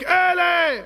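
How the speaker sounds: high, loud held cry; statue's shout. Acted. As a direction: shout it out long like the idol crying aloud. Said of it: "הפסל צועק".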